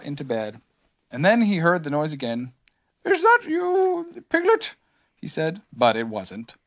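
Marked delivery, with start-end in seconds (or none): 3.0-4.8 high, drawn-out bear voice; slow, high-pitched figure calling out; Pooh. A narrator's reading. Pooh asks who is making the noise, high voice. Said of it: "said Pooh sleepily".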